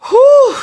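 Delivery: loud high "whew" of relief; exhausted whew. Real, not acted. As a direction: blow out a tired whew. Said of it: "I am tired".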